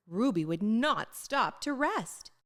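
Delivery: ordinary delivery, nothing marked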